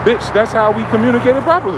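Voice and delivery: high, loud indignant snap; defiant comeback; mock retort.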